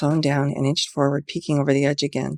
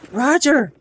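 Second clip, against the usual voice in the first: high, loud anguished cry; wretched scream; 11 semitones higher, 5 dB louder than usual. A narrator's reading. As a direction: tear the name out of her throat. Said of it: "One more wretched scream tore".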